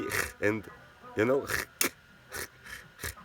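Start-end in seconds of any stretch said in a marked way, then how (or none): 1.5-3.1 ch said as a rough throat sound, repeated; Swiss German ch. Shown as a demonstration. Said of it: "we speak like that".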